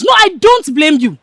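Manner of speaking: heated, contemptuous dismissal; scornful outburst. Acted. Repeats it, now openly berating friend.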